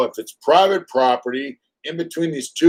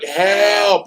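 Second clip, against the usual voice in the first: higher cry, odd voice; mock yell for help; 7 semitones higher than usual. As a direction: imitate his yell, hammed-up. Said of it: "he's like".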